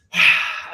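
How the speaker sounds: loud breathy exhale; audible sigh. Real, not acted. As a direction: let out a big settling sigh. Exhales settling into meditation.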